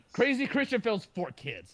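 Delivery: loud, high title announcement; triumphant announcement. Real, not acted. Finally getting the film's title right, triumphant loud announcement.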